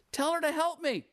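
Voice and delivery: high-pitched acted indignant plea; exasperated demand. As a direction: play an upset sister demanding help. Preacher voices upset Martha demanding.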